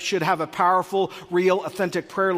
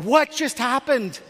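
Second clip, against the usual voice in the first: bewildered; 6 semitones higher, slower than usual. Retold or imitated. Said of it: "You're like".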